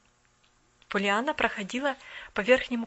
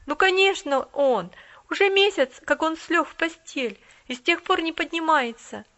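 lightly voiced boy, a bit raised; boy blurting the news; plain boy reply, a touch louder; boy's voice; 5 semitones higher, 7 dB louder than usual. A narrator's reading. Boy answers.

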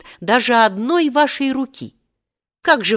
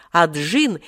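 usual speaking voice

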